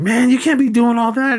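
high-pitched mock objection; imitated protest. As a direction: protest high, as if scolding a buddy. Voicing Craig's protest in film.

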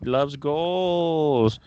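slow, loud playful repeat; slow drawn-out echo. Pretend. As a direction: stretch out each word. Echoing cohost slowly.

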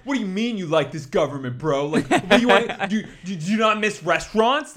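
half-laughing mock of an indignant bro; debate bro. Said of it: "libertarian debate bro energy here".

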